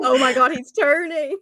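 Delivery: laughing through the words; giggling disbelief, slowed down. Real, not acted. Laughing at friend's werewolf act.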